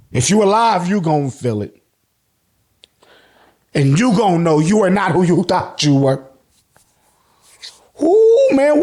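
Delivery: ordinary delivery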